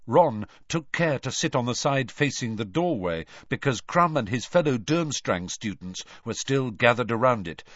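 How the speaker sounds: ordinary delivery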